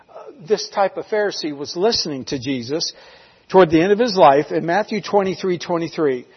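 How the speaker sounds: ordinary delivery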